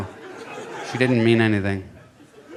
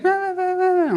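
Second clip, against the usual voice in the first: put-on very high garbled toddler babble; high-pitched little kid; slower than usual. Toddler answering his hard-of-hearing dad, high-pitched babble. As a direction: babble unintelligibly in a tiny falsetto. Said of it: "he's got this high voice".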